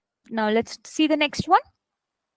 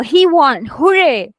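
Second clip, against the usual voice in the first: louder cheer on hooray; raised-voice exclamation; 6 dB louder, slower than usual. Shown as a demonstration. Teacher shows learners how to read an exclamation, raised voice. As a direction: lift the voice into a punchy exclamation. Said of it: "you have to raise your voice".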